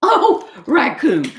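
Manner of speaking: slow, laughing oh Raccoon. A narrator's reading. Duck laughing at over-packed Raccoon, slow and amused. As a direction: laugh fondly at a friend. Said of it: "Duck fell over laughing".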